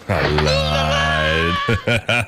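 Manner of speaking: deep mimicry of an action hero; Arnold Schwarzenegger. Radio host parroting a favourite film clip, deep action-hero impression. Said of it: "Arnold Schwarzenegger".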